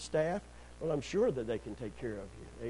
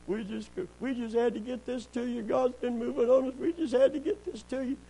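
high-pitched imitation of earnest givers; pleading couple; 9 semitones higher than usual. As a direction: voice their urgent plea, pitched well up. Voices the couple handing over check.

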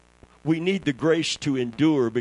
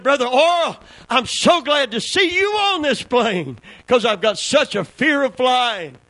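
nervous passenger; 8 semitones higher, 6 dB louder than usual. Retold or imitated. Scared passenger addressing Oral Roberts.

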